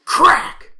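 shouted CRACK, high and loud; loud crack sound effect. Pretend. Reading sound effect aloud.

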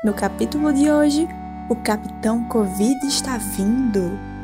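ordinary talk

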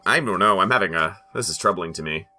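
ordinary talk

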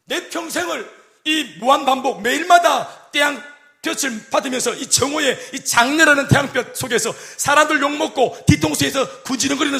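slow, high, half-chanted sermon cadence; preaching at full force. Acted. Pastor to congregation, slow and sung-out preaching. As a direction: draw out each phrase like a lament over a hard life.

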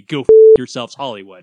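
loud burst of outrage; angry shout. Real, not acted. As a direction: yell it at Hollywood. Mock outrage at Hollywood reboots.

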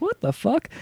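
spotting a surprise face on screen, stunned; shocked disbelief. Retold or imitated. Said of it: "I saw him on screen and I was like".

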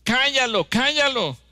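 high, loud scolding shout; disciples shouting. Retold or imitated. Disciples shout down the blind beggar, high scolding yell. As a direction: yell at him to shut up. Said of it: "los discípulos gritaban".

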